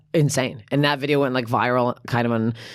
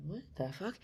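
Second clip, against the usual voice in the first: barely voiced, stunned aside; hushed disbelief; 20 dB quieter than usual. Shopper just yelled at outside store, hushed bafflement. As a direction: say it under your breath, baffled. Stunned reaction in retold accusation.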